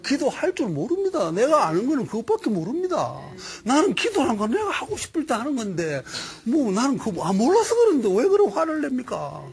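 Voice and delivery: a simple man defending himself, rising in pitch; shepherd protesting to Moses; pleading shepherd. Retold or imitated. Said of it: "양치기가 이렇게 말했습니다".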